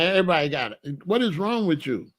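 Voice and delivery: ordinary talk, nothing marked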